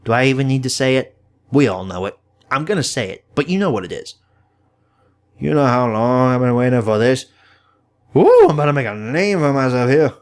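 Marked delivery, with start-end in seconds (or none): 5.4-10.1 swagger it like a smug card-throwing rogue; Gambit quote. Retold or imitated. Gambit eager for a fight, cocky loud impression. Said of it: "The Gambit quote".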